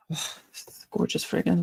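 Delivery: breathy rapt whisper of delight. Real, not acted. Vlogger sniffing her perfume, rapt whisper. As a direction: breathe it out as if overcome by the scent.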